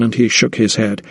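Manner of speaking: ordinary delivery, nothing marked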